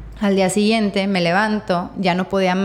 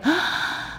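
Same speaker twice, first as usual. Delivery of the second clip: sharp gasp; 8 semitones higher, 6 dB quieter than usual. Retold or imitated. World fell at father's hug.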